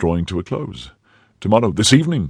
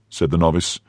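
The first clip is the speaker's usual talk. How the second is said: usual speaking voice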